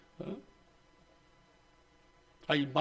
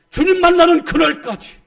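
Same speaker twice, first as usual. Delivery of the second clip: high, loud proclaiming cry; God's promise proclaimed; 10 semitones higher, 12 dB louder than usual. Acted. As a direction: call out the promise with full force. Preacher voicing God's promise loudly.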